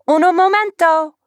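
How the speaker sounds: slipping into Spanish. A narrator's reading. Waitress botching her fake French with Spanish. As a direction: fumble into a fake Spanish accent. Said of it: "that's Spanish".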